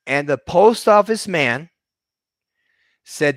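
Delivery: ordinary talk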